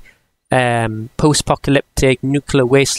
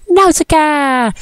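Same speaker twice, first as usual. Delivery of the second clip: high, slow drawn-out call; shouted name; 11 semitones higher, 4 dB louder, slower than usual. Pretend. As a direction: belt the name out dramatically.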